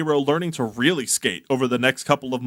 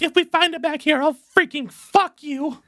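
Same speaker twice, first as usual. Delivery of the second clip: put-on high-pitched girly voice; squeaky high girl.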